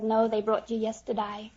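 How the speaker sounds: normal talking voice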